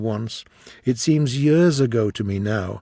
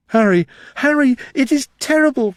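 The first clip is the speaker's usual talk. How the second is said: much higher, louder despairing outcry; anguished cry; 12 semitones higher, 7 dB louder than usual. Acted. Dorian hears how Sybil died, crying out in horror. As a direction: cry out his friend's name in horror. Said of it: "cried the lad".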